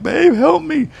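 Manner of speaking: very high, whimpering plea; squeaky pretend-crying voice; mock crying. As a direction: imitate yourself tearfully begging for help. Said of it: "crying, dude".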